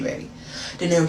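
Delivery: ordinary talk, nothing marked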